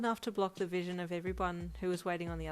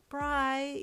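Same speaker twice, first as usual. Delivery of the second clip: wailing; 8 semitones higher, 5 dB louder than usual. Acted. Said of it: "you wailed".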